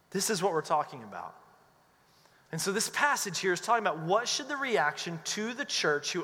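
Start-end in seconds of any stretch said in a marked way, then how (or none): none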